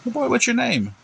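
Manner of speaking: put-on very high-pitched question to a little kid; policeman to child. Policeman addresses small child.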